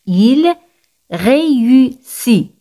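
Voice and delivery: "Il ... réussit", very slow, each syllable separated. Shown as a demonstration. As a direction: stretch out "réussit" sound by sound after a pause. Teacher breaks phrase into syllables.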